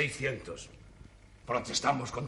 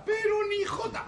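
slow and much higher, stretched out; fed-up insistence he understands nothing; exasperated; 3 dB louder, slower than usual. Acted. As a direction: throw up your hands. Exasperated emphasis.

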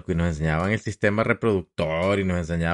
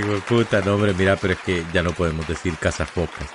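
ordinary talk, nothing marked

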